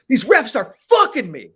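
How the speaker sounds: loud, high, indignant griping; athlete's angry complaint. Retold or imitated. Pro athlete griping about referees, loud and indignant. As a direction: vent like a player sure the refs are against him.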